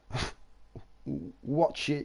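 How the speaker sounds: normal talking voice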